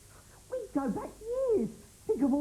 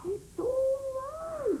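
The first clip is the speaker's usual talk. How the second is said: high, slow soothing call; slow, high reassurance to a raging pirate; 12 semitones higher, much slower than usual. Acted. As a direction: coax him down gently.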